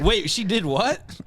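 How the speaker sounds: slow, high-pitched incredulous question; shocked disbelief. Acted. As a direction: act out stunned disbelief, stretching it out. Acting her children's shocked reaction.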